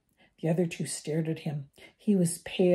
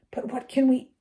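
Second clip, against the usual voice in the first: gasping; 7 semitones higher, 3 dB louder than usual. A narrator's reading. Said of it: "Hermione gasped".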